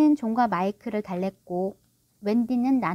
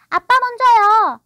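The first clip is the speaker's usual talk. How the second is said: put-on high, loud, drawn-out child's voice; slow, insistent little-boy protest; suspicious small boy; 12 semitones higher, 11 dB louder, slower than usual. A narrator's reading. Michael telling his father to go first, suspicious. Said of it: "의심 많은 마이클이 말했다".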